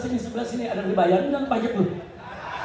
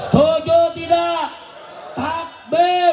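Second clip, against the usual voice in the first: high, loud call for agreement; ringing rally cry; rallying shout; 7 semitones higher, 4 dB louder, slower than usual. Real, not acted. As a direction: shout to draw a roar from the crowd. Rallying crowd, calling the takbir.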